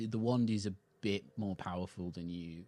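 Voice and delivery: normal talking voice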